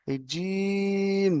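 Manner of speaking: very slow recitation of letter names; drawn-out letter recitation. Shown as a demonstration. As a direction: stretch each letter name long and sung. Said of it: "এখন পড়াবো, দেখেন".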